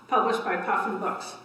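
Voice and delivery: usual speaking voice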